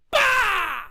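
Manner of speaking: sharp imitated slap; smack sound.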